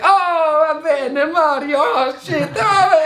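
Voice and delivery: high-pitched, exuberant Italian impression; excited Italian energy. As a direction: burst out like an animated Italian. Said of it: "It's like this Italian energy".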